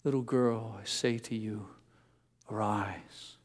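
barely above a breath, tenderly; gentle, tender. Retold or imitated. Jesus speaking softly to the dead girl, tender hush. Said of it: "so gently and tenderly".

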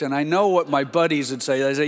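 ordinary delivery

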